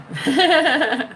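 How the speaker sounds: short chuckling laugh; real laugh. Real, not acted. Host amused by own boast, chuckling. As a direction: chuckle at your own line.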